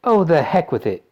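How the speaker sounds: impatient, defiant outburst. A narrator's reading. Violet defies Wonka's warning.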